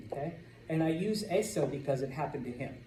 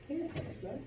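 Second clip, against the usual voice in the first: ¿Qué es esto? thrown up high, falling; acted question; 11 semitones higher, 9 dB quieter than usual. Teacher acting out asking a classmate, high. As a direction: ask it high like you're really puzzled.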